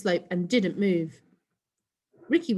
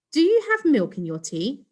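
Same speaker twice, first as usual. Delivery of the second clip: higher, louder affected hostess; posh hostess; 7 semitones higher, 6 dB louder than usual. Acted. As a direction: keep up the posh act. Mum still putting on posh.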